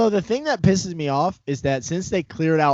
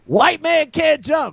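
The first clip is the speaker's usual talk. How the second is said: high drawn-out movie catchphrase; film line impression; 11 semitones higher, slower than usual. Comic quoting a basketball movie title, high drawn-out impression. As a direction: belt out the catchphrase, high and stretched.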